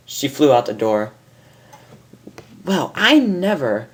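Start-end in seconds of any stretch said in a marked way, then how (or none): 2.6-3.9 higher, slow, prim huff; offended mare. Acted. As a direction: sniff with offence.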